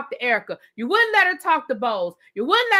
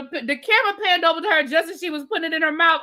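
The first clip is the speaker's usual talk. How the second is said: usual speaking voice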